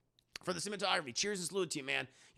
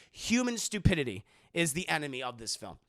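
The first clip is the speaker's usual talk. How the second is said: normal talking voice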